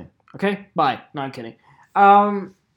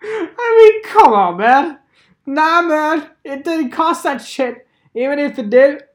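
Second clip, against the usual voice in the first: high, loud, laughing disbelief; can't believe that price; incredulous protest; 10 semitones higher, 5 dB louder than usual. Real, not acted. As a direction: protest in a much higher pitch. Scoffing at the inflated price.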